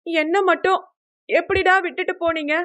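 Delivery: high, hurt complaint; tearful reproach. A narrator's reading. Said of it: "அழ ஆரம்பித்தாள்". (அழுகையோடு கோபமாக முறையிடும் குரல்)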